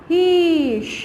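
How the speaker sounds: drawn-out "हीश" with long sh; long hissing word; snake hiss imitation. Shown as a demonstration. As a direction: let the ending hiss like a snake. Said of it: "snake का sound कैसा होगा".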